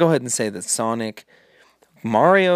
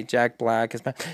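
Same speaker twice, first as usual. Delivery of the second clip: ordinary talk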